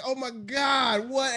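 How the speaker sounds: high, slow drawn-out gasp; mock shock. Student acting stunned at [name] news, slowly. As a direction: play up exaggerated surprise.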